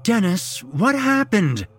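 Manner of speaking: high, loud, drawn-out plea; pleading. A narrator's reading. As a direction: beg him for an answer. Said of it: "she pleaded".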